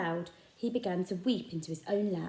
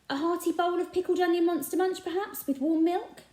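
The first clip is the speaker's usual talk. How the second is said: coaxing shopkeeper; 11 semitones higher, 5 dB louder than usual. A narrator's reading. Raj coaxing.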